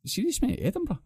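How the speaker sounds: high, disbelieving question; incredulous. Retold or imitated. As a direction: sound shocked at the price. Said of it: "I says".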